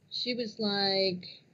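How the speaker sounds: usual speaking voice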